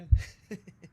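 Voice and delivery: high mocking laugh from a video; imitated woman's laugh. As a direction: copy her laugh, high and short. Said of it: "Did he laugh like that woman from the video".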